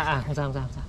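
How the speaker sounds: ordinary delivery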